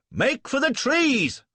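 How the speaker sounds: slow, high, loud yell; urgent battlefield yell. A narrator's reading. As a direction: shout the order across the gateway. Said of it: "I yelled".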